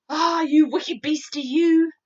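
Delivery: loud, slow scolding; scolding cry. A narrator's reading. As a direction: scold the beast in the jar. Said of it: "cried the BFG".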